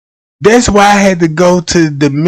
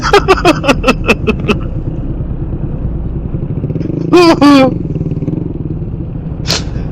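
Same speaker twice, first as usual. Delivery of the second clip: long silent wheeze laugh; 7 semitones higher, 6 dB quieter than usual. Real, not acted. Cracking up at his skit.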